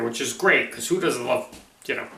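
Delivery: ordinary talk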